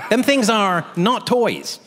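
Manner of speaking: high, slow comic admonition; hammed-up cautionary punchline; mock warning. Closing mock warning for laughs.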